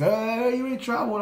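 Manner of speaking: high, slow sing-song schoolyard jeer; mocking kids' taunt. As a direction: tease like a kid chanting at a classmate in trouble. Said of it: "like this".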